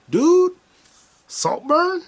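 high, eager call out; high excited pitch. Real, not acted. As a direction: hype up the movie to a friend. Excited recommendation.

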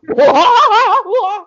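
loud, high burst of real laughter; a full belly laugh that takes over; big laugh. Real, not acted. Cracking up loudly at her friend's teasing about her laugh. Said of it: "That laugh, though".

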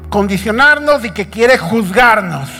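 climbing sermon cry; rising preaching shout. Acted. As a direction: drive the warning home at full force.

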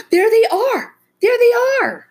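high, loud shriek of discovery; excited yell. A narrator's reading. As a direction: scream it out, pointing across the lot. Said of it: "she began to yell".

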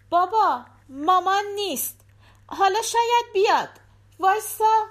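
put-on high, eager child's voice; little girl voice. A narrator's reading. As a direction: play the small daughter at the door.

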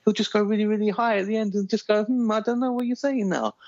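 fast, run-on ramble climbing steadily very high; mocking motormouth impression, ending in a high questioning squeal; Vince Vaughn. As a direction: rattle on without breathing, pitch creeping up into a question. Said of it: "he always seems to just talk like this".

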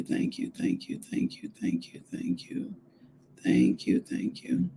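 hushed, breath-only thanks to God; soft whispered praise; whispered prayer. Real, not acted. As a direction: murmur thanks under your breath, over and over.

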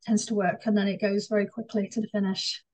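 normal talking voice

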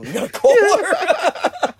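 high, loud line bursting into laughter; mock frustration collapsing into a laugh; cracking up. Frustrated driver retelling getting lost, breaking up laughing.

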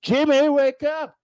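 high, slow coaxing call; imaginary caretaker calling Jimmy awake, high and slow; mock wake-up call. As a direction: drag it out like waking a lazy kid. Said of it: "No one's gonna say that".

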